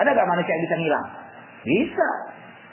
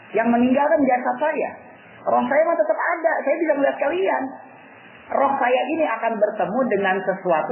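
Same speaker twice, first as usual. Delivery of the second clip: lightly acted teacher, higher-pitched and insistent; mildly acted exhortation in a raised pitch; dying guru urging joy; 7 semitones higher than usual. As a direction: urge grieving pupils to rejoice, pitched up. Dying guru chides weeping pupils.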